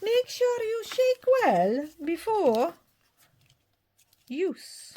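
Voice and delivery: a daft Scottish-ish character reading the label; weird Scottish accent. Pretend. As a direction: stretch "shake well before use" out in a squeaky Scottish mix voice. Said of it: "kind of a weird Scottish mix thing".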